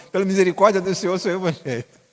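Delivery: mock-pious "Pela misericórdia" proclaimed; exaggerated pious boast. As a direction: ham up the pious show-off. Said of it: "mas fala assim".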